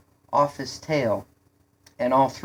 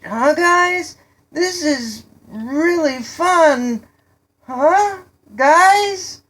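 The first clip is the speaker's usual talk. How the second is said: high, loud, slow drawn-out calling; yelling to friends; 13 semitones higher, 9 dB louder, slower than usual. A narrator's reading. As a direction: holler with forced fun, ending on rising pleas. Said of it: "he yelled".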